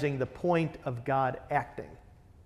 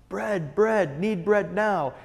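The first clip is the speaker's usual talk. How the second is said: put-on simple toddler demand; 4 semitones higher, slower than usual. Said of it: "it's like a toddler".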